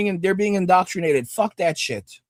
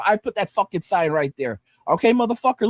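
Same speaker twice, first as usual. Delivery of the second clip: ordinary delivery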